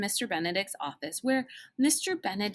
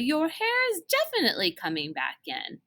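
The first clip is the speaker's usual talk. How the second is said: matter-of-fact; 8 semitones higher than usual. A narrator's reading. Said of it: "she said matter-of-factly".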